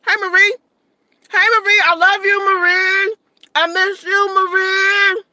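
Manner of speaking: high, loud, gushing greeting; excited calling out. Real, not acted. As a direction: holler affection at a dear friend. Calling affectionately to viewer Marie.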